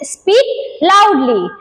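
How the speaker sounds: drawn-out teacher command; slow model phrase. Shown as a demonstration. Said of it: "तो हम लोग English में क्या बोलते हैं".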